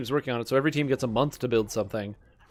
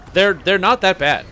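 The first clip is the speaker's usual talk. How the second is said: normal talking voice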